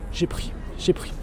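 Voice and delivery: unvoiced slow whisper. Real, not acted. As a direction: whisper it sheepishly. Sheepish admission.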